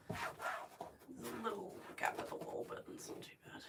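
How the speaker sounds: soft whisper, slowed down. Real, not acted. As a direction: murmur it under your breath. Whispering while working.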